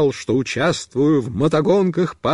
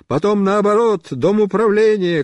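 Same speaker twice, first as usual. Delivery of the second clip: ordinary delivery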